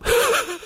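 squeaky high laugh; a quick high-pitched giggle. Real, not acted. Laughs at own slip.

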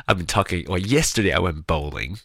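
ordinary delivery

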